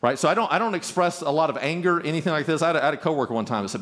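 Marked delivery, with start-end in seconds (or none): none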